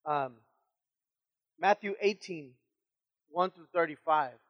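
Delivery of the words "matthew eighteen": ordinary talk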